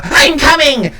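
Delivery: high, loud call from afar; excited character shout. Acted. Shopkeeper answering his foghorn doorbell, high excited holler. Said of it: "you hear him go".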